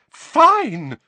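very high, loud shout. A narrator's reading. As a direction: shout it in anger. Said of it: "shouted Missus Weasley".

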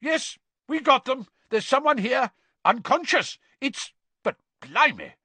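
high, loud report called from afar; excited shout turning astonished. A narrator's reading. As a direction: shout your discovery back. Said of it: "they heard Mister Diggory shout".

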